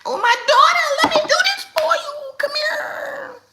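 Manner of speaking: very high, loud cooing mother; mock doting mom. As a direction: overdo the smothering, sugary rescue.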